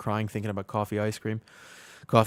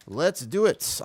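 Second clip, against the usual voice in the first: higher, sing-song rising hype call; excited; 6 semitones higher, slower than usual. Real, not acted. Streamer rallying his group, higher and sing-song. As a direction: pump the team up with a bouncy rise. Hyping up starting the dungeon.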